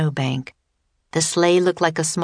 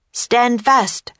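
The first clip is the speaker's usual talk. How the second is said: high, urgent order; raised-voice command; commanding cry; 6 semitones higher, 3 dB louder than usual. Acted. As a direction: call out a warning to hold on. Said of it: "cried Teddy".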